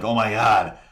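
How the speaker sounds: exasperated. Retold or imitated. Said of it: "where I'm like".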